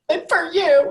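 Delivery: high, loud, mock-tearful outburst; retold crying. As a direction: imitate wailing it at Dad. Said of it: "And I'm crying".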